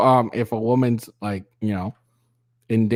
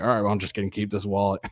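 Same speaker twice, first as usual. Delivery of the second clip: resigned guy; 2 semitones lower, 5 dB quieter, faster than usual. Retold or imitated. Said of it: "he's like".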